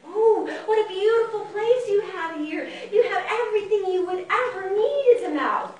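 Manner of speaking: high-pitched admiring little mouse; Jumping Mouse voice. Acted. Jumping Mouse admiring the old mouse's home, high and impressed. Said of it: "Oh, and Jumping Mouse said".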